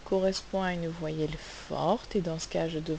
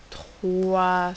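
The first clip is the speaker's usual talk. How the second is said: "trois" stretched long and loud; drawn-out, emphatic "trois"; emphatic stress; 7 dB louder than usual. Shown as a demonstration. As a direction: lean hard and slowly on "trois". Teacher stressing the number of sounds.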